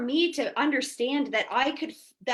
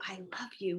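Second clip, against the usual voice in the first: whispered "I love you", voiceless and much softer; 4 semitones lower, 11 dB quieter, faster than usual. Retold or imitated. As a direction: barely breathe "I love you" at your reflection. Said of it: "look at themselves in the mirror and say".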